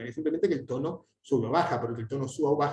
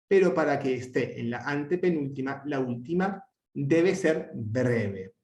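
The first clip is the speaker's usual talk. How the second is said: ordinary talk, nothing marked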